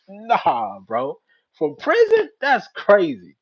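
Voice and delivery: high, loud incredulous reaction; loud disbelief. Retold or imitated. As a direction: act out being floored by the news. Acting out his disbelief at the call.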